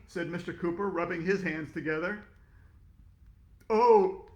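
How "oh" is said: a loud startled oh; surprised. Acted. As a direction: caught off guard. Delivery guy correcting them.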